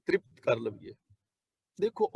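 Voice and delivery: ordinary delivery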